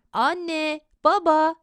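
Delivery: put-on child calling for mum and dad. A narrator's reading. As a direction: call out to parents through the house. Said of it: "Elini ağzının yanına koymuş bağırıyor".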